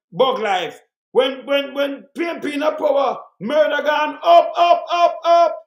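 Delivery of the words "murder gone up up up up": each up pushed higher and louder; rising outrage, up louder each time; building emphatic up. Real, not acted. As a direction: hammer every up as it climbs. Rant on murder rate rising.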